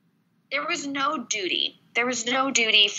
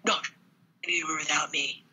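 lower, hammed-up protective hero; mock heroic pleading; 7 semitones lower, faster than usual. Friend mocking the book's hero, hammed-up desperate pleading. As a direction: play the overdramatic bodyguard, half-whispered at the end.

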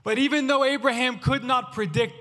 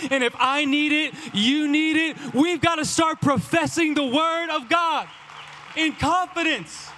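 climactic preaching; 6 semitones higher than usual. Acted. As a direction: lift them to the close.

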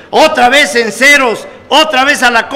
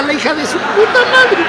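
laughing; 7 semitones higher than usual. Retold or imitated. Joking about his wife, laughing.

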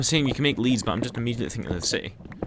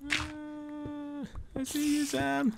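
very high, soft held notes; tiny silly voice; 11 dB quieter, much slower than usual. Pretend. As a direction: squeak it softly from far away. Spotting friend from afar.

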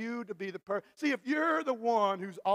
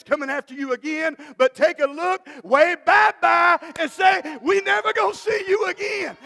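loud, high, shouted preaching climax; preaching at full force; 9 semitones higher, 11 dB louder than usual. Real, not acted. Pastor rousing the congregation, shouting loud. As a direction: build it up to a roaring sermon peak.

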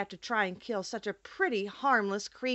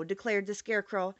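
usual speaking voice

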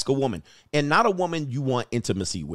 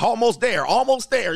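high, loud repeated urging; eager woman; 7 semitones higher, 4 dB louder than usual. Retold or imitated. Woman urging partner she is almost there. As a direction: chant it at him impatiently. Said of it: "She like".

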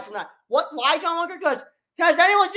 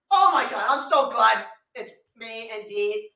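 usual speaking voice

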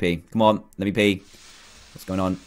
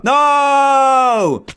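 panicked shout; 7 semitones higher, 4 dB louder than usual. Real, not acted. Falling into danger in game.

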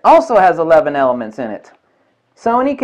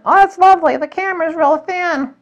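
much higher, gushing put-on voice; mock admirer; 12 semitones higher than usual. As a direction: coo over the thin camera.

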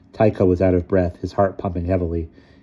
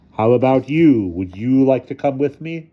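loom over him with the question; menacing invitation; 6 semitones higher, 6 dB louder than usual. A narrator's reading. Figure threatens Ludwig with abduction, menacing.

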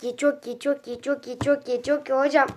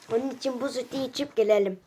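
ordinary delivery

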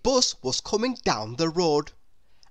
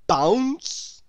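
louder, springy call; bouncy exclamation; 5 semitones higher, 5 dB louder than usual. A narrator's reading. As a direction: let the word bounce up. Bounces off the chair.

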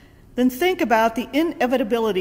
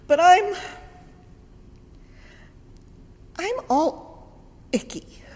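whiny Lazarus; 9 semitones higher than usual. Retold or imitated. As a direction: sulk like an embarrassed child. Lazarus whines from inside tomb.

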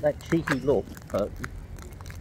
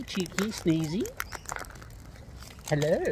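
ordinary talk

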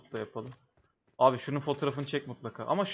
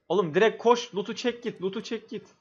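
urgent shouting; 7 semitones higher, 4 dB louder than usual. Real, not acted. Urging teammate mid-fight.

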